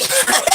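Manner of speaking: high shrieking burst of laughter. Real, not acted. Vlogger cracking up at her own skit, shrieking. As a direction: break up laughing.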